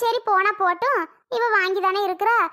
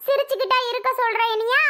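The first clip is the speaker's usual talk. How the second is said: higher-pitched, crying protest; tearful retort; 4 semitones higher than usual. Acted. Grandmother asked why she is crying, tearful retort. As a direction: answer back through tears. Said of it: "எதுக்கு இப்ப அழுதுட்டு இருக்கியா".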